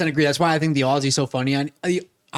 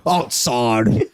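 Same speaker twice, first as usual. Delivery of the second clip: drawn-out mock Australian vowels; Australian accent; 4 semitones lower than usual. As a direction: echo it with a broader Australian accent. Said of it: "That was definitely more Australian".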